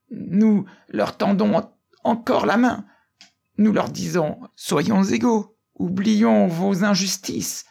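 high, impassioned orator's cry; choked-up cry. A narrator's reading. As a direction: cry out to the club, overcome. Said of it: "crier d'une voix étouffée".

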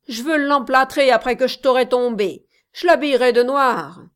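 loud, fast menacing shouts; hit hard crying out. Acted. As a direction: yell threats at the rival.

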